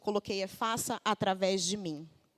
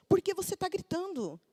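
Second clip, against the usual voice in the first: higher mock daughter voice throwing the question back; daughter talking back; 10 semitones higher than usual. Daughter turning mom's question back on her, higher mock voice. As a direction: imitate the kid turning it on mom. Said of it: "ela falou assim".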